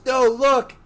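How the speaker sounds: high, loud alert; retold urgent call. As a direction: call out to make him look. Pete urgently pointing out the shark.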